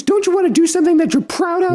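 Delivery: high mock mom voice. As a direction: ask it as a scolding mom. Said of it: "me trying to sound like Mom".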